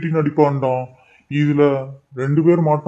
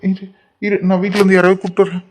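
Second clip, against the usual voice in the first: higher, urgent worried voice; alarmed concern; 5 semitones higher than usual. Acted. Boy offering to fetch help, alarmed. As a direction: panic at her sudden pain.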